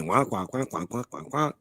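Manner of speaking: muted trombone wah-wah sounds; Charlie Brown adult voice. Retold or imitated. Mocking how women talk, like Charlie Brown's teacher. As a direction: mimic the grown-ups from Charlie Brown. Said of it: "That Charlie Brown shit".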